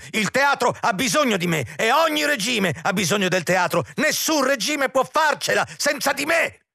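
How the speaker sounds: very high, hysterical proclamation; desperate cry. A narrator's reading. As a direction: call it out shrilly after someone gone. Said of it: "gridò l'intendente al giardino buio".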